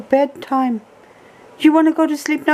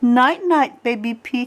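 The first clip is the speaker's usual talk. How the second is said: ordinary talk, nothing marked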